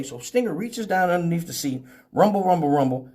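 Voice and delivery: ordinary talk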